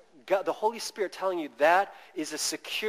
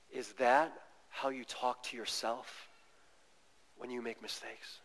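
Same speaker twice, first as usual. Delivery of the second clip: much softer, tender retelling; gentle reply; 5 semitones lower, 9 dB quieter, faster than usual. Retold or imitated. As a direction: soothe her quietly before asking. Husband answers angry fiancée gently.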